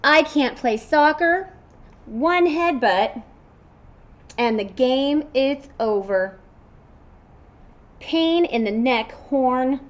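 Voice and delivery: storybook unicorn whining; complaining unicorn. Acted. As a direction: grumble about the popped ball. Unicorn complains about soccer.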